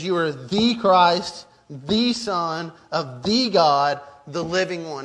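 each THE stressed, slow and drawn out; emphatic declaration. Retold or imitated. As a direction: proclaim it strongly, leaning hard on every "the". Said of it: "he's saying it as strong as he can".

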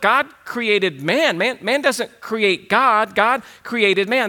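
"God created man" spelled out slowly, each word weighted; a slow, deliberate preacher's emphasis; slow emphatic declaration. Acted. Preacher stressing the core claim.

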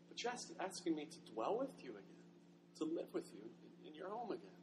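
hushed, barely believing it; awed disbelief. Acted.

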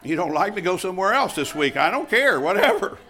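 loud, high, don't-care defiance; defiant. Real, not acted. Pastor daring critics to leave, loud and defiant. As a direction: shrug them off at full voice.

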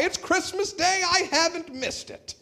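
put-on high, thrilled old Scrooge; Scrooge overjoyed. Scrooge replies to the boy, high and overjoyed. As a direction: Scrooge bursting with relief. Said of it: "what does Scrooge reply".

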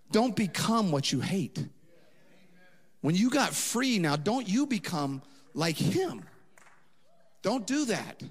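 slow, loud, high warning; slow, loud preached warning; slow forceful exhortation. Acted. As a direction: plead with them slowly.

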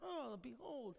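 soft, airy little murmur; mock underwhelmed reading. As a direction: read it softly, as if it means nothing. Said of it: "like, we're like".

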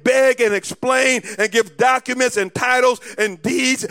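loud, rising preacher's cry; preaching at full force. Acted. Preacher rallying the congregation, loud and soaring. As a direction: build the church to a roar.

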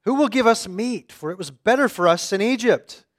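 loud, high, whining grumble; complaining Israelites. Acted. Preacher voicing hungry Israelites complaining, loud and whiny. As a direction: moan about the food like a crowd.

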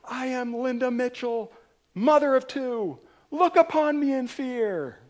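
hammed-up battle cry; heroic declaration. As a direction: announce yourself like a fearsome warrior mom. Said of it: "she's like".

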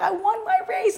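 high, laughing burst of victory; explosive joy. Retold or imitated. Student bursting in after a track win, high and laughing. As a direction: burst in bragging about the win. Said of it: "be explosive and be like".

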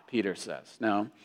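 usual speaking voice

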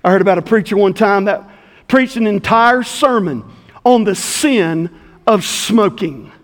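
ordinary talk

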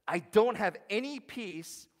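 loud, slow, high cry of despair; Job's anguish. Retold or imitated. Job crying out to God in suffering, loud and slow. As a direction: wail it out, slowly. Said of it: "this is what Job says".

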